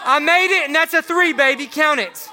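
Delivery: shouting a cocky brag over the made three; loud mock trash talk. Preacher bragging to the youth group after a fake three, loud and cocky. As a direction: hype yourself up loudly after a pretend shot.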